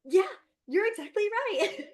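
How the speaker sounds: high, loud gushing approval; delighted praise. Retold or imitated. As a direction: cheer the child on, all delight. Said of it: "I was like".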